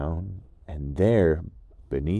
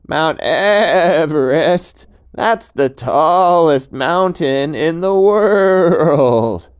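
much higher, louder, slow astonished goat; amazed goat; 9 semitones higher, 14 dB louder, much slower than usual. Acted. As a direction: gasp at the idea of Everest. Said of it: "the mountain goat said".

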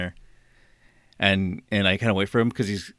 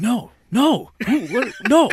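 high, loud, mock-panicked protest; flustered refusal; 11 semitones higher, 6 dB louder, slower than usual. Man rejecting the prank caller, flustered. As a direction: blurt it in rising alarm.

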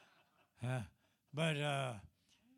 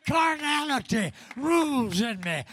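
preaching; 4 semitones higher, 6 dB louder than usual. Acted. Preacher shouting testimony.

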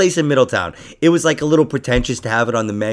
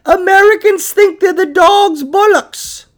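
put-on high, loud, slow drunk English voice; mock English accent; 8 dB louder, slower than usual. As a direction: sneer it like a tipsy Brit. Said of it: "they'd be like".

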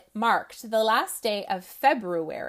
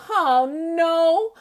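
groaning; 6 semitones higher, 6 dB louder than usual. Acted. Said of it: "Rabbit groaned".

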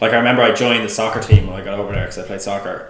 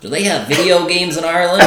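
lightly mocking a clueless teen, higher; teammate's dumb question; 6 semitones higher than usual. Retelling a teammate teasing him, mock dumb voice. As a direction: imitate a dopey American kid asking. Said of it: "he was like".

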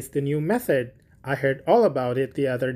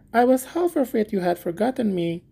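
higher, eager simple gardener voice; little Hans; 7 semitones higher than usual. Acted. Hans thanking the miller for asking, high and eager. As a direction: play Hans as a humble, grateful friend.

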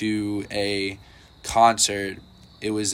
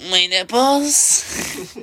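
high, slow, drawn-out squeal; mock girl squeak; 11 semitones higher, 4 dB louder, much slower than usual. Friend retelling a viral video, high slow squeal. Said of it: "she just goes".

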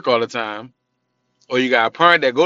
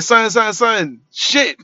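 mimicking little girl; 7 semitones higher, 4 dB louder, much slower than usual. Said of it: "she say his name like".

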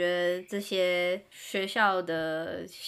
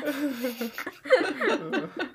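laugh; 6 semitones higher than usual. Real, not acted. Laughing at own joke.

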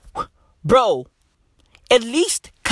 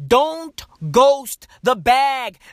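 very slow preachy warning; 2 semitones higher, much slower than usual. Acted. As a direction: stretch each word of Don't ghost the bag. Slower repeat.

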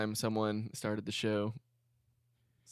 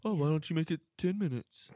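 higher, cheeky backtalk; sassy kid; 5 semitones higher than usual. Retold or imitated. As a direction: taunt the teacher like a sassy kid. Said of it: "student backtalk retold".

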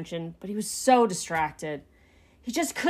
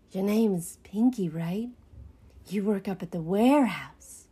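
lightly voiced rat character; a hint of a sly informant; shady rat. A narrator's reading. Rat checks who Pinky is, lightly sly.

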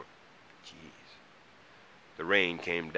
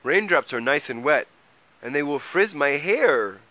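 high, loud troll complaint; crying out; 8 semitones higher, 9 dB louder than usual. A narrator's reading. Troll fussing to Mole about frizzy hair, crying out. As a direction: cry it out, higher and louder. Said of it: "cried Troll".

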